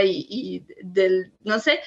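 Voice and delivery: normal talking voice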